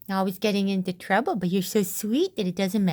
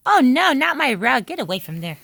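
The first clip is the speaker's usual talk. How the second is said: loud, higher cry of protest; scolding; 4 semitones higher, 8 dB louder than usual. Real, not acted. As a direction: protest loudly as the puppy goes for the rug. Scolding puppy biting the rug.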